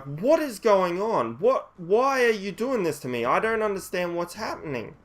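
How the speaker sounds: high-pitched, distressed pleading protest; courage built up. Retold or imitated. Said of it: "I build up the courage".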